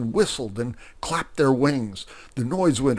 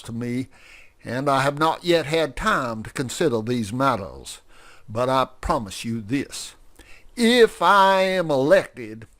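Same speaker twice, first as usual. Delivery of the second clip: speechify like a self-important candidate; pompous politician; 4 semitones higher, 6 dB louder than usual. Acted. Grover gives a pompous speech.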